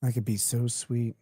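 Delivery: quiet, dry mock of the line; soft mocking echo. Repeating the guy's text, quiet and mocking. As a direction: murmur his words back with disdain. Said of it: "quotes the line again".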